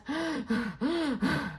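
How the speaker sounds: winded gasp; worn-out deer gasping; breathless gasp. Acted. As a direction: gulp for air. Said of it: "gasping for breath".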